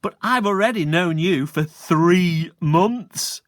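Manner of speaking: put-on cheeky boy teasing; smug joke. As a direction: set up the punchline slyly. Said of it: "said Stuart".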